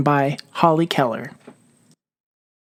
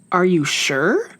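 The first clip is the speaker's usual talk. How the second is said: plain reading voice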